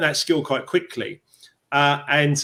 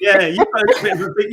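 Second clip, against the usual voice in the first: laughing remark; 6 semitones higher, 7 dB louder than usual. Real, not acted. Amused remark on accent.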